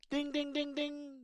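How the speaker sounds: high chime ding ding; high bell-like dings; game show ding. Pretend. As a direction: ring it out like a quiz bell. Game show correct answer.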